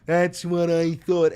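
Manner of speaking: puffed-up leader scolding; mock scolding. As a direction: lay down the law, smugly. Scolding chat member.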